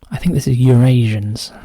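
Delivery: ordinary delivery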